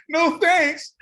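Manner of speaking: high, laughing through the punchline; laughing repeat. Retold or imitated. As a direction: repeat it while cracking up.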